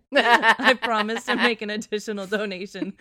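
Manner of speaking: loud reading that breaks up into laughter; laughing. Real, not acted. Reader finishing the letter's mock apology, laughing harder. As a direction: read on through rising giggles.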